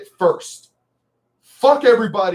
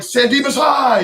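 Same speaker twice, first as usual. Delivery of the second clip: hollered mock-idiot jock cheer, pitched high; 6 semitones higher than usual. Streamer mocks the jock in the first Bill and Ted, idiot jock shout. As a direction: holler it like a dim jock at a rally. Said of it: "sounded like an idiot".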